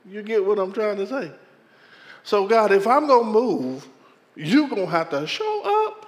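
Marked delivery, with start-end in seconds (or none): 2.2-6.0 firm raised demand; challenging God. Retold or imitated. Pastor challenging God to show up, firm and raised. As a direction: lay down the condition to God. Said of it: "I said it just like that".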